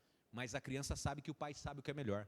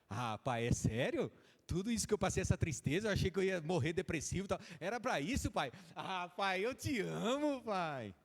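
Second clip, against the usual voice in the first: louder, higher gushing gratitude; overjoyed believer; 5 semitones higher, 6 dB louder than usual. Acted. Believer thanks God in loud, high joyful gushing. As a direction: burst out in thankful joy.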